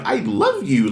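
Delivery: ordinary delivery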